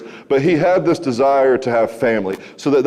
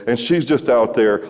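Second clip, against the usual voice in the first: usual speaking voice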